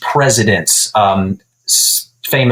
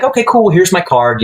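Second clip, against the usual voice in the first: upbeat okay; 9 semitones higher than usual. Retold or imitated. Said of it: "So I was like".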